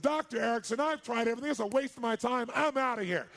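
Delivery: loud, high-pitched outburst of giving up; heated retold complaint; exasperated patient. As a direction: play a fed-up patient, pushing up the volume. Retold patient confronting Erickson.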